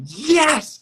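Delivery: high, loud, drawn-out yes; hammed-up squeal of approval; thrilled agreement. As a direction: imitate a delighted makeup artist's outburst. Acting out makeup artist's thrilled agreement.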